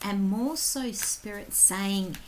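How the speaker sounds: soft, intimate whisper-like tarot reading; hushed reading voice. Acted. Tarot reader opening the February reading, hushed and soft. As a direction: lean in and read the cards softly.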